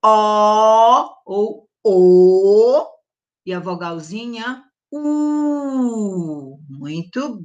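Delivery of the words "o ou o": very slow, loud "O ou O", first "O" closed, then open; two O sounds. Shown as a demonstration. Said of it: "olha o som".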